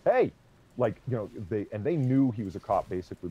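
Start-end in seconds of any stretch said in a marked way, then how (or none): 0.0-0.3 high, loud call through the window; loud cop shout at a car window. Retold or imitated. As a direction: rap on the glass and call him awake. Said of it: "the cop taps on his window and goes".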